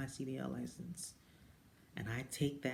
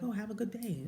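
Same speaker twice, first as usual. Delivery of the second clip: higher, singsong goodbye; cheerful greeting; 9 semitones higher, 3 dB louder than usual. Retold or imitated. Imitating the power-walking lady, high singsong. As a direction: toss off a friendly wish as she leaves. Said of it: "she was like".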